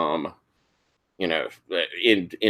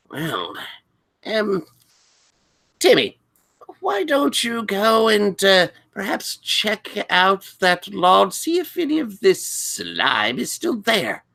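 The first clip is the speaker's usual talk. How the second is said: high, hesitant, interested librarian; Doctor Pritchard; 10 semitones higher than usual. Acted. Librarian sending a student to check the lawn, high and interested. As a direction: coax delicately in a high voice. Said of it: "Pritchard looking interested. He goes".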